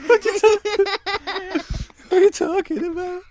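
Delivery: high, helpless real laughter through words; laughing. Real, not acted. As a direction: ask it while cracking up. Parent cracks up at child's remark.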